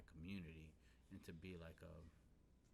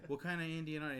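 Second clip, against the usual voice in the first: imitating his disbelief, higher and louder; retold incredulous question; 8 semitones higher, 9 dB louder, faster than usual. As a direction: act out a loud, baffled question. Same retold question, repeated for emphasis.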